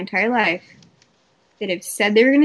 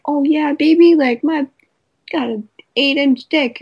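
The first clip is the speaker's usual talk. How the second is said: higher, louder macho brag; mock boasting man bragging about size; mock cocky guy; 5 semitones higher, 5 dB louder than usual. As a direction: puff up like a boastful dude.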